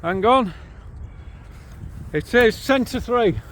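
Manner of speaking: loud, high answer called to a passer-by; calling out. Real, not acted. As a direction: call the time back across the path. Answering stranger across distance.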